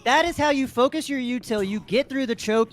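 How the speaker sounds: ordinary delivery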